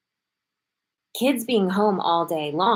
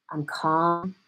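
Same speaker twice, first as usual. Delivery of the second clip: ordinary talk, nothing marked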